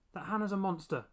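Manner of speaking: high, loud eager finish; eager blurt. A narrator's reading. Boy finishes his mother's sentence, loud and eager. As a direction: blurt it out triumphantly.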